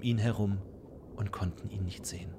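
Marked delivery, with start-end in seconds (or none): 1.1-2.3 storyteller whispering; whispered narration. A narrator's reading. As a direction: hush it like a secret.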